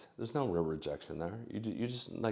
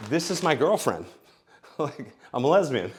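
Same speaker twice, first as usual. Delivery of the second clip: louder and higher, through laughs; mock woman, laughing; 6 semitones higher, 8 dB louder than usual. Woman telling him she's with her girlfriend, laughing. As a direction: laugh while playing her. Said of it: "she might say".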